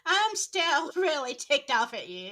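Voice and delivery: loud, laughing pretend grudge; mock huffy. Brushing off an unwanted guide in the afterlife, mock huffy. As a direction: playfully snub them at full volume.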